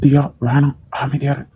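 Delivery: hushed plea. Acted. Hushing her during family row.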